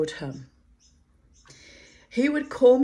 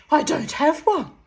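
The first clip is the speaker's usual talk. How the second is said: prim, starchy and much higher; 10 semitones higher, 8 dB louder than usual. Retold or imitated. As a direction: sniff it out like an offended lady. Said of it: "say starchily".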